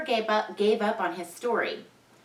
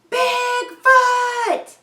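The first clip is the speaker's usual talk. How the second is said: high, loud cry of the name; excited shout; 8 dB louder than usual. Acted. Ben shouting to the town, high and loud. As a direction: yell it like you just spotted him.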